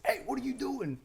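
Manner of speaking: high-pitched, imitated aggressive challenge; aggressive callout. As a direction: act out a squad leader calling out sharply, voice jumping up high. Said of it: "He's a very aggressive guy".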